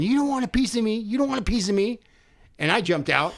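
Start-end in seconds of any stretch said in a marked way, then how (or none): 0.0-2.0 retold yelling. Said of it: "he's yelling".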